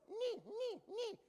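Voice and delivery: a hammed-up Python knight cry; Knights who say Ni. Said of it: "a little Monty Python".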